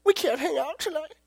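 mock sad girl voice; upset girlfriend. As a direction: voice the girlfriend cancelling the date. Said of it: "she's just like".

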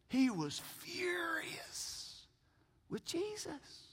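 drawn-out, whispered outrage; hushed intensity. Shown as a demonstration. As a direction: whisper it for dramatic weight, stretching "furious". Dramatizes the official's indignation.

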